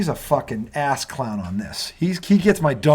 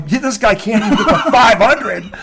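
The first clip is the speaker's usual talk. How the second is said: higher, louder shouting jabs; heated rant; 9 semitones higher, 6 dB louder, slower than usual. Real, not acted. Host yells at Bill Burr about Brady, louder and higher. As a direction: hammer each point, yelling his name.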